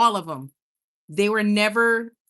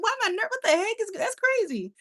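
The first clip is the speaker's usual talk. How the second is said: very high, rushed mock disbelief; acted bafflement; 12 semitones higher than usual. Streamer puzzling over nerves on videos, in high mock bafflement. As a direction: squeal it out in comic confusion.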